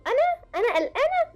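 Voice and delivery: incredulous, climbing high in pitch; high, mock-offended questioning; indignant denial. A narrator's reading. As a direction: act shocked at the very idea. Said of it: "بعدت عينيها بإنكار".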